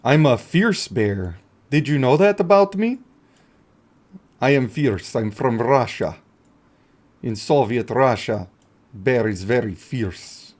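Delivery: a hint of a Russian bear boasting; Russian accent. Streamer playing a bear, in a mild Russian accent. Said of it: "Am I doing a good Russian accent?".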